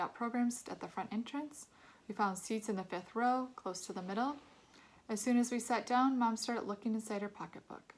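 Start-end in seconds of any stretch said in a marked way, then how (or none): none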